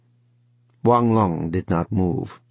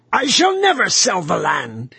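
much higher, louder shriek; 11 semitones higher, 4 dB louder than usual. A narrator's reading. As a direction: scream your defiance. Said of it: "he shrieked at them".

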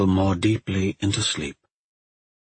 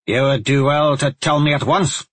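put-on raised, insistent older woman; pressing demand; 6 semitones higher, 4 dB louder than usual. A narrator's reading. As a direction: press her hard for an answer. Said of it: "Shona pressed".

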